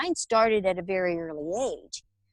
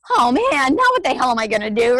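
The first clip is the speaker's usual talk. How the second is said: flustered panic; 4 semitones higher, 7 dB louder, faster than usual. Retold or imitated. Panicked realization, can't check out.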